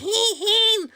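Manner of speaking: high, loud giggle at his own bad singing; laughing at himself. Real, not acted. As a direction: burst into a laugh. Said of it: "I know that was probably the worst".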